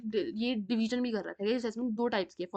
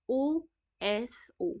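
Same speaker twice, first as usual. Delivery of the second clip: drawn-out mnemonic; much slower than usual. Shown as a demonstration. Teacher drilling ओएसओ for students, stretched very slowly. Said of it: "याद रखती हूँ ऐसे".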